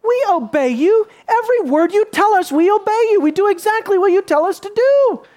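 loud, high mock protest; eager Levite. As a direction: play a zealous Levite protesting to God. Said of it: "could have gone to God and say".

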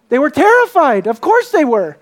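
high, loud burst on TERRIFIED, then falling; emphatic exclamation. Real, not acted. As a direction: exclaim it as plainly obvious. Preacher exclaims at obvious fear.

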